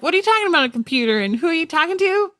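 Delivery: mock baffled ancient listener; high, disbelieving questions; incredulous. Imagining biblical people hearing of computers, incredulous.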